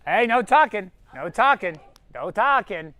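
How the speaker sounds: loud, low no talking; stern order. Real, not acted. Host silencing the contestants, stern and loud. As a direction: shut the chatter down sternly.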